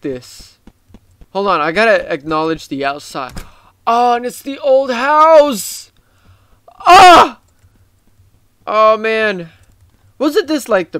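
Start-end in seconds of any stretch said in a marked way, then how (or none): 3.9-5.9 nostalgic delight. Real, not acted. Recognizes the old game house. 6.8-7.4 a high, loud yelp of excitement; sharp excited yelp. Real, not acted. Gamer spotting a classic Roblox detail, high loud yelp.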